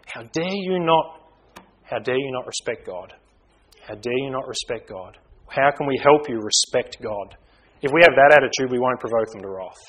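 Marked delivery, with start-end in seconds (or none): 0.1-1.2 acted indignation. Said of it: "and you just think". 1.8-5.2 much softer, subdued rebuke; softened reproof. Acted. Father rethinking his rebuke to a child, hushed gentle reproof.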